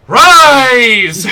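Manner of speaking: high, loud, straining scream of a command; shrieking summons that nothing answers; desperate shout. Acted. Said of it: "And Oral goes".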